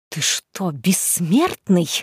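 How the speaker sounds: low, slow hissed threat; hissing. Acted. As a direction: hiss it through the teeth. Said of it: "прошипела Лемейна".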